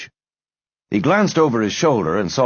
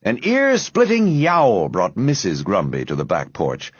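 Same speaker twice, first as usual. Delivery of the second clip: ordinary delivery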